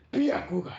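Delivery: high, loud cry of the technique; power-up shout. Retold or imitated. Naruto activating the Byakugan, battle shout. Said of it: "And Naruto says".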